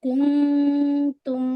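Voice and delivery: کنتم with the n hidden in a long nasal hum; slow sustained chant of کنتم, n nasalised; ikhfa. Shown as a demonstration. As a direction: hum through the n of کنتم before the t. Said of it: "نون کو اخفا کریں گے".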